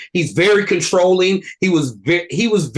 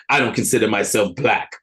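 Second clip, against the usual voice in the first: Jeffrey Wright impression; 7 semitones lower than usual. Quoting the movie character's line to co-hosts, lightly imitated actor's voice. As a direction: quote the film line in a slightly put-on, lower voice. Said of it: "really good Jeffrey Wright impression".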